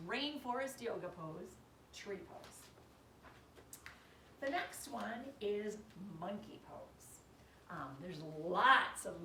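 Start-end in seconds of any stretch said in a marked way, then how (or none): 2.0-2.7 whispered. Shown as a demonstration. Pose name whispered to kids. 6.2-7.2 whispered. Shown as a demonstration. Pose name whispered, slow.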